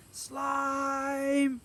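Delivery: high, loud, drawn-out call; shouted summons. A narrator's reading. Summoning his friend Slime, loud high shout. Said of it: "Shout louder".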